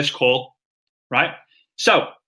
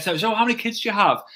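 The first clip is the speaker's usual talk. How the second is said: higher-pitched chatty question, lightly acted; interested questioner; 6 semitones higher, faster than usual. Coach models asking a prospect about family, interested questioner. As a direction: play the curious new acquaintance. Acting out a getting-to-know-you chat.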